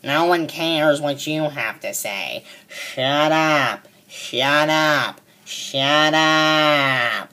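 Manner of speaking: slow, low sneering imitation of a coworker; hint of a nagging coworker, mocked slowly. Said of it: "she'll butt in and say".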